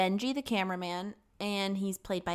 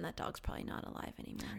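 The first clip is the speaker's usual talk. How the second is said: whispered aside; 14 dB quieter than usual. Real, not acted. Friend whispers a gloomy aside about the dog, whispering. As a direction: drop to a whisper for the sad aside.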